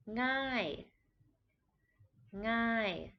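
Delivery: ngai with a falling tone. Shown as a demonstration. Teacher models Thai ngai with falling tone. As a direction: start ngai from the back of the throat and fall. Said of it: "Also a falling tone".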